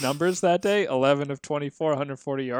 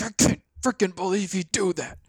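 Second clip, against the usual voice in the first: livid dad; 6 semitones higher than usual. Retold or imitated. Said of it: "like, livid".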